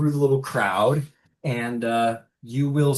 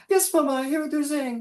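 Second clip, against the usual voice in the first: put-on very high girlish voice, quick; young daughter; faster than usual. Crying daughter answering her mother, very high girlish voice. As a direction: answer Mama in a high, hurried voice. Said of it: "She goes".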